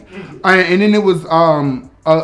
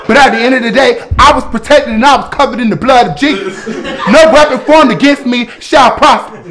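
louder, laughing church declaration; mock preaching; 5 semitones higher, 5 dB louder than usual. Closing her story, mock-preaching the blood of Jesus.

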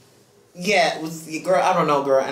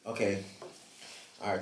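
ordinary talk

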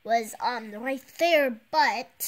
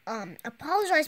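ordinary delivery

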